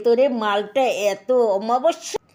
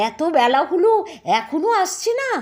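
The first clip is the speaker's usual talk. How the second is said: impatient calling; 6 semitones higher, 3 dB louder than usual. Acted. Wife waiting, impatient.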